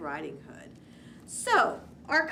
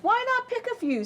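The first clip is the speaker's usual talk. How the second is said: much higher coaxing wolf; tempting wolf; 14 semitones higher than usual. Acted. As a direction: coax her sweetly off the path.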